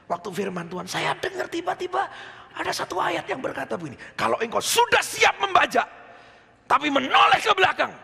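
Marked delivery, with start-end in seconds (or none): 4.2-7.9 proclaimed verse. Acted. Quoting a Bible verse forcefully.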